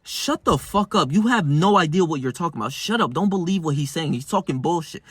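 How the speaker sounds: ordinary talk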